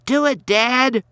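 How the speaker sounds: put-on a spoiled kid urging his dad to fight; bratty kid. A narrator's reading. Ronnie goading his dad to hit Santa, high and bratty.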